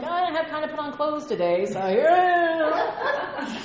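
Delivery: high, loud silly excuse, drawn out at the end; mock excuse. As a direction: act out an absurd excuse with a long stretched finish. Acting absurd excuse for nakedness.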